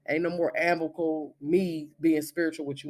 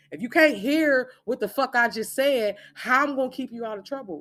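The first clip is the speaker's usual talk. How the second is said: real scolding yell; frustrated shout; 8 semitones higher, 3 dB louder than usual. Real, not acted. Scolding Renisa directly.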